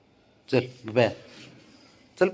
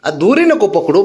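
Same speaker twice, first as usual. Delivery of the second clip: sharp telling-off; 10 semitones higher, 9 dB louder, slower than usual. Real, not acted. Stopping the pet biting the leash.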